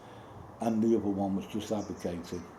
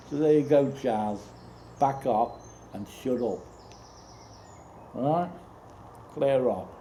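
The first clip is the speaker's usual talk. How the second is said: angry telling-off; 4 semitones higher, 4 dB louder than usual. Real, not acted. Tells Charles off directly.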